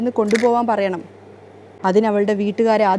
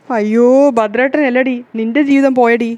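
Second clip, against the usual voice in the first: loud lament; 5 dB louder than usual. Acted. Mother mourning her daughter's marriage, loud wail. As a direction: wail over the daughter's ruined life.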